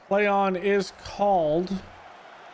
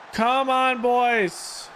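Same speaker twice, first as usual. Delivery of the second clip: urging the team; 6 semitones higher, much slower than usual. Real, not acted. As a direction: fire up the players.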